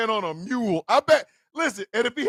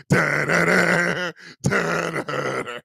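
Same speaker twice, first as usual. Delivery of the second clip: hummed Terminator theme; 4 semitones lower, much slower than usual. Pretend. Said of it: "The Terminator music is playing".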